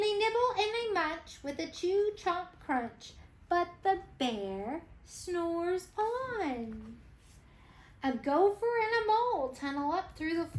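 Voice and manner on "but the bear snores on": slow and soft. A narrator's reading. Refrain repeated slowly.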